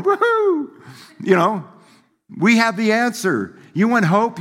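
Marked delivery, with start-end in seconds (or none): 0.0-0.7 much higher, a bursting cheer of joy; joyful whoop. Pretend. Preacher shows how believers should cheer, high joyful whoop. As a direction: let out a happy celebration whoop. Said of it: "We should be out there just going".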